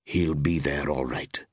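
low, slow, assured; quiet confidence. A narrator's reading. Young man reassures a stranger, with quiet confidence. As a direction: say it low and sure. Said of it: "with quiet confidence".

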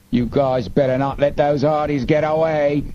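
high, cutting threat; Snapman. A narrator's reading. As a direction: gang boss calling a warning to his men. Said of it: "Snapman's voice cut through the night".

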